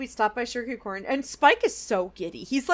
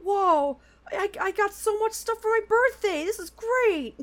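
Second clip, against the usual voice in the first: lightly voiced, higher-pitched excited kid; acted excitement; 8 semitones higher than usual. Spike thrilled with his birthday gifts, excited. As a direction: gush in a higher voice. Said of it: "and he's so excited".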